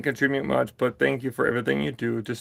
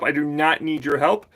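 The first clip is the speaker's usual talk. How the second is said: usual speaking voice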